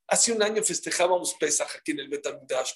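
ordinary delivery

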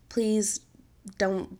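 usual speaking voice

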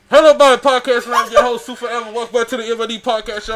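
loud, high show opener; podcast host intro. Acted.

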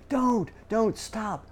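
high, sharp parental cry of alarm; urgent warning to a child. Retold or imitated. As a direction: stop a toddler before he drinks gasoline. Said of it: "You would give a warning".

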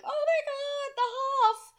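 high-pitched imitation of starstruck Germans; mock fan gushing. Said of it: "they all like".

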